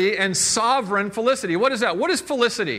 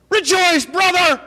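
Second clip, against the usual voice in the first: much higher and louder hail to a brother; exuberant greeting; 10 semitones higher, 7 dB louder, slower than usual. Acted. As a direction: throw the greeting out like a joyful shout. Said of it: "you walk up".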